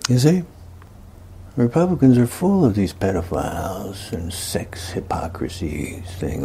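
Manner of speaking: ordinary talk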